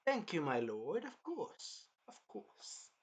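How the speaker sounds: mock sly villainess purring, fading to a hiss; imitating a scheming snake of a woman. Retelling Carmilla to a friend, sly snake voice. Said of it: "Like a snake".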